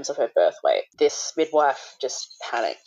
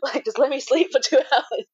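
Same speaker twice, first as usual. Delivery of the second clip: high, breathless laugh through the words; 12 semitones higher than usual. Real, not acted. Mum jokes about relief at baby being taken, laughing. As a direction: crack up while talking, squeaking out the line.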